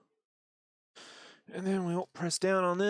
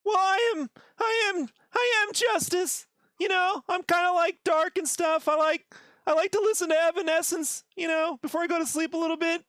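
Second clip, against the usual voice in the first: high, rambling nervous cartoon kid; Morty; 8 semitones higher than usual. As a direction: pitch it way up and babble on hesitantly. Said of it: "as Morty".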